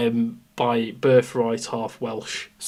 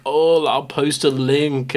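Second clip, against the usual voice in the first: mock chat voice; 3 semitones higher, 5 dB louder, slower than usual. Imitating chat.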